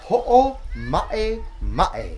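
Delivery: Ho'o ma'e ma'e with a catch before each vowel; glottal stops. Shown as a demonstration. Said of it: "thank you for putting those little moments in there".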